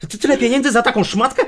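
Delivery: high, loud disbelieving outburst; outraged shock. Acted. Husband seeing his wife's price tag, outraged shock. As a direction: explode at the cost, pitch jumping up.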